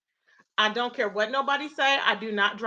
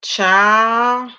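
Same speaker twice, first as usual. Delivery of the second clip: exasperated loud drawn-out exclamation; 2 semitones lower, 9 dB louder than usual. Real, not acted. Reacting in disbelief to chat.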